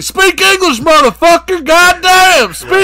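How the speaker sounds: high, loud yelling at a foreigner; furious shout. Retold or imitated. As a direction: scream at him to speak English. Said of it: "David Parker Ray is like".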